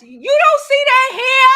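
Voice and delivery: outraged disbelief at the mess; exasperated. Retold or imitated. Scolding about mess left around.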